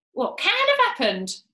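high, loud, bewildered question; baffled alarm. Acted. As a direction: ask it in hammed-up bewilderment. Mrs Twit baffled by the stick.

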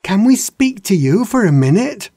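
high, loud call across the lake; young dragon calls out, high and loud, to the Naga King. A narrator's reading. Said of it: "called out".

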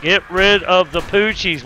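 ordinary delivery

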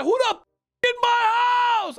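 really loud yell. Retold or imitated. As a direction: bellow it high and stretched at the intruder. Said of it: "I yell it really loud".

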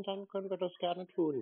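usual speaking voice

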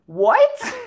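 stunned real shock; surprised. Real, not acted. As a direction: react in disbelief. Shocked at earthquake news.

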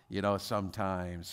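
soft, low and slow; sweet, unbothered wife. Retold or imitated. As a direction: say it gently, without any annoyance. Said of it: "she said this so sweetly".

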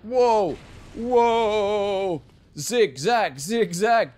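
loud high urgent yells; loud panicked calls; frantic dodging. Real, not acted. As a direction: yell while dodging bullets. Dodging gunfire in game.

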